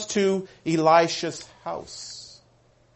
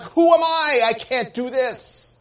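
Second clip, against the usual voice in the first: high, raised-voice protest of a king; upset king; 6 semitones higher, 4 dB louder, slower than usual. Retold or imitated. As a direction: throw it out as an overwhelmed, upset king. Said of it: "And the king was upset".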